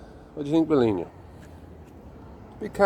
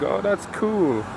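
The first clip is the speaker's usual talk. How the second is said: slow, higher mock-impressed teen; lightly mocking teenage awe; mock teenager; 8 semitones higher, slower than usual. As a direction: parody a naive teen admiring graffiti. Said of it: "you think".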